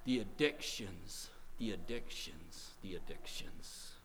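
slow, low, soft repetition, fading away; hushed gravity. Real, not acted. Preacher naming addictions to congregation, grave and hushed.

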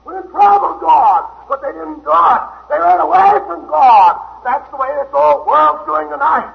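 loud, high preaching at full force; a revival preacher calling sinners home; fiery preaching. Acted. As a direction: thunder it out to the congregation.

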